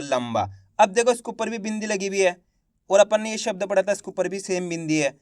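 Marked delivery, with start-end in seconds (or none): 0.0-0.5 लंबा much lower and softer, m closing the first syllable; लंबा said quietly as lam-ba; soft half ma demo. Shown as a demonstration. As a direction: murmur लंबा with the m held. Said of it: "आधा माँ का उच्चारण निकला है".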